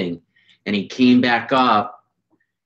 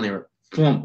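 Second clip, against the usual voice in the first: ordinary talk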